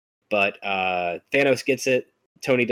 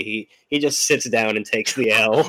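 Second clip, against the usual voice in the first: usual speaking voice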